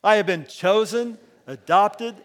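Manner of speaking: loud, slow declaration; loud, slow congregational declaration; declaring together. Shown as a demonstration. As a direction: lead the room in a creed. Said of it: "Let's say these together".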